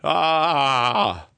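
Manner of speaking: held-back mock yell of outrage; half-hearted yell. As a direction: let out a long, restrained "ah" of fake outrage. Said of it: "give me something to yell about".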